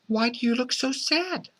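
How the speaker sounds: high, soft motherly question; soft, concerned mom, pitched high; mommy's voice. Acted. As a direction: ask gently in a high, soft voice. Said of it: "Mommy asked".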